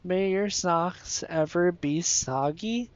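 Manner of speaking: ordinary talk, nothing marked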